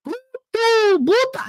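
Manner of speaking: "boop" hit in a high, mock over-emphasis; oddly over-emphasized. Imitating mother's attempt at Spanish, oddly emphasized "boop". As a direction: imitate exaggerated stress on each high "boop". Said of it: "why she emphasizes it the way she does".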